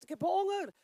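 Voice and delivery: lightly voiced demanding body, up and loud; hungry body. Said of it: "En dat lichaam zegt".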